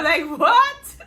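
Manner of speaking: loud, laughing, rising in pitch; laughing disbelief. Real, not acted. Interviewer recalling the guest's odd intro, laughing disbelief. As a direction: crack up in amused disbelief, loudly.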